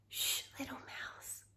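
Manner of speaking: soft hushed shh, little mouse; library whisper. A narrator's reading. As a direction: breathe it out like a secret. Refrain whispered again.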